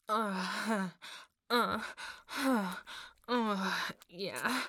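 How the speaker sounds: loud, breath-heavy groans; loud moaning. Acted. Speaker to partner in intimate scene, moaning loudly. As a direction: groan out in repeated bursts.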